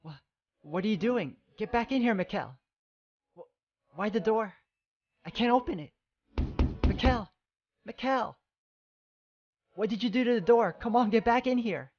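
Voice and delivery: high, loud panicked shouts through a locked door; frantic calling. Acted. Giselle calling Mikhail through the locked door, frantic shouting. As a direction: yell for him, pounding at the door in panic.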